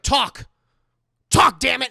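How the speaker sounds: loud, hard talk damn it; angry demand. Retold or imitated. Mother grabs the doll, loud angry demand. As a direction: snap the order at the doll.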